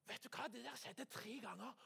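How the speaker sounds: plain reading voice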